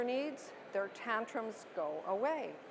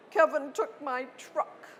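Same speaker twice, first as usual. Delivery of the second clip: put-on higher, louder whiny three-year-old; upset toddler; 4 semitones higher, 5 dB louder than usual. Shown as a demonstration. Toddler Tyler telling mom his brother took his truck, higher whiny child voice. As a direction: complain like a sulky little boy. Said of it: "angry, crying three-year-old".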